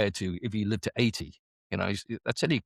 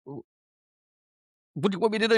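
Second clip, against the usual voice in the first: incredulous protest; 9 semitones higher, faster than usual. Retold or imitated. Protesting to his QM.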